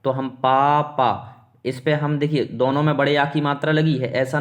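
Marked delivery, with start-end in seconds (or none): 0.4-1.1 पापा with the first पा drawn out and leaned on; slow पापा, heavy on the opening syllable; stress on first syllable. Shown as a demonstration. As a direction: stretch the first पा of पापा, then the second. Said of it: "इस पर ज़्यादा बल देते हैं".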